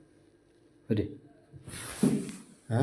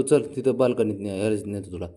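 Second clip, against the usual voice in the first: ordinary delivery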